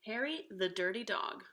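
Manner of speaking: ordinary delivery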